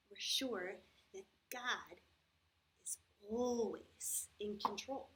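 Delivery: each word slowed right down; slow and deliberate. Acted. Teacher reassures the children, slow and deliberate. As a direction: spell out the reassurance slowly. Reassuring kids about the coronavirus.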